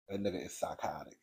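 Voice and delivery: very soft, low whisper; whispered aside. Real, not acted. Reviewer's verdict on the husband after mimicking him, whispered. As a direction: confide it under your breath.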